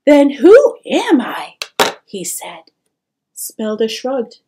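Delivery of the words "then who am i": put-on loud, higher, shocked boy; stunned disbelief. A narrator's reading. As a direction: demand it in bewildered hurt. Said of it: "stared in silent disbelief".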